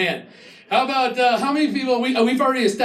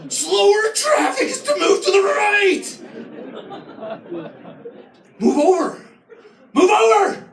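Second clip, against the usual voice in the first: hammed-up fury behind the wheel; mock road rage; 5 semitones higher than usual. Comic yelling at a driver hogging the left lane.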